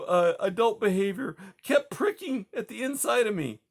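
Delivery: high, cracking tearful confession. Acted. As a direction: confess it while fighting back sobs. Grieving widow confesses doubt.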